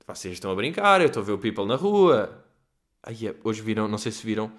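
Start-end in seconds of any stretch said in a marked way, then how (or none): none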